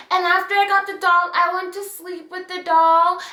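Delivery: imitating a child's lilting story; little girl storytelling. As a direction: recount it like a cute little kid. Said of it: "You know how kids tell stories".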